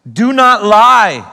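slow, loud and high with "not" hit hard; emphatic command. Shown as a demonstration. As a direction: hammer the command "Do not lie" home. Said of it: "slow emphatic reading of command".

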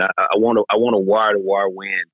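usual speaking voice

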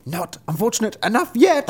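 high-pitched bird taunt; sparrow. A narrator's reading. Sparrow answering the driver, high taunting voice. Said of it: "answered the sparrow".

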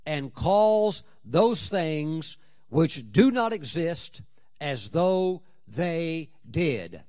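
very slow, weighty declaration; preacher slowing to drive the verse home; slow emphasis. Acted. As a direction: spell it out word by word. Preacher slowing key phrase.

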